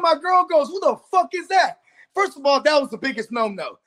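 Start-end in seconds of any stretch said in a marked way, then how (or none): 0.7-1.8 mock-indignant demand, pitched up; indignant girlfriend. Girlfriend confronting him over a stranger's call, indignant. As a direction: imitate an irate girlfriend, higher voice.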